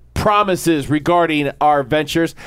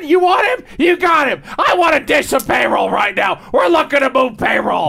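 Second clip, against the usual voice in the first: hard sell ad; 7 semitones higher than usual. Pretend. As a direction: bellow it like a crazy salesman. Continues Crazy Al ad.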